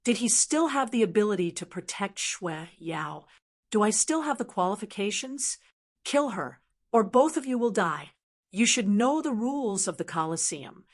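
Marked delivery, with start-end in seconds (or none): none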